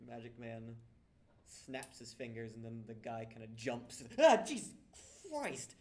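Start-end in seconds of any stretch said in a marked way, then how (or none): 4.2-5.7 sudden loud startled cry; startled shout. Acted. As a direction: jump in fright and yell it. Startled at the magic man.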